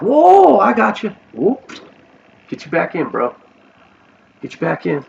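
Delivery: high, loud catch-you shout; eager call. Retold or imitated. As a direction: call out like grabbing someone before they fall. Someone catching an addict, calling out.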